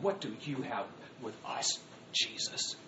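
low, soft condescension; condescending. Acted. Scribes and Pharisees addressing Christ, condescending. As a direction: quietly look down on him. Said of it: "they had that same condescension towards him".

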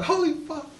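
very high amazed exclamation; awed holy fuck; stunned. Retold or imitated. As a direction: gasp it out in disbelief. Stunned reaction to throw.

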